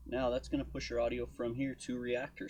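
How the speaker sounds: usual speaking voice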